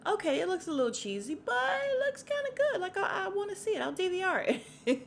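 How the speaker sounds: high-pitched, wavering interest; tempted hedging. Retold or imitated. Viewer won over by a cheesy movie promo, high hedging. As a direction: talk yourself into it in a squeaky voice.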